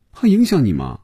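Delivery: put-on high-pitched girl's voice; girl's reply. A narrator's reading. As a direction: voice the girl, pitched well up. Girl answers in acted dialogue.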